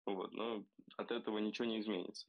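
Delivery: ordinary delivery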